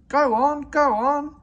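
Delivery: high, loud shout of the trucks; yelled. A narrator's reading. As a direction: trucks shouting as they surge forward. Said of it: "they yelled".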